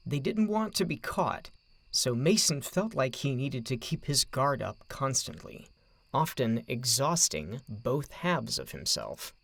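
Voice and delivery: ordinary delivery, nothing marked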